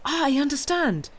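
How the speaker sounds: high, loud burst of realisation; sudden exclamation. A narrator's reading. Granddaughter suddenly grasping grandfather's meaning, exclaiming. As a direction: cry it out as it dawns on you. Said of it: "Valentine exclaimed suddenly".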